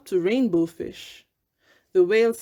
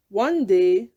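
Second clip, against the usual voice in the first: usual speaking voice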